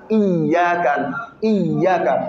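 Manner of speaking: Iyaka with the ya doubled, iyyaka. Shown as a demonstration. Teacher showing the doubled ya to students. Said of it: "ça montre que le ya est deux".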